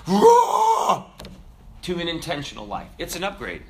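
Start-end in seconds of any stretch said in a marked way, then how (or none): 0.1-1.0 high, loud, slow cry of "raw"; raw outcry. Real, not acted. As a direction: let "raw" burst out high and stretched. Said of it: "exclaiming intense life".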